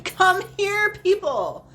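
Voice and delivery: loud, high playful call. Acted. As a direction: summon everyone across the room. Calling out to empty room.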